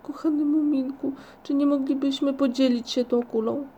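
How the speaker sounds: high, wheedling little plea; moaning plea. A narrator's reading. Ryjek begs Muminek to share the globe, moaning. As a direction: beg a friend plaintively to share. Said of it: "jęczał Ryjek".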